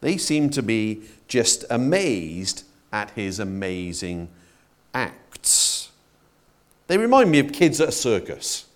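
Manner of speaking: ordinary talk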